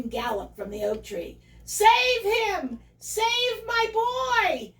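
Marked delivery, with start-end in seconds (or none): 1.6-4.7 high, loud, desperate mother's cry; agonized yell. Acted. Mother sow yelling for her drowning son, in agony. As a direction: yell desperately, stretching the words out. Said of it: "yelled Missus Barley Love in agony".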